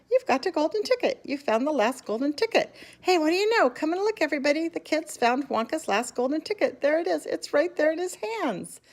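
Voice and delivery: a man beside himself with joy; excited shouting. A narrator's reading. Shopkeeper calling everyone over to see the ticket, high and excited. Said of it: "screamed the shopkeeper".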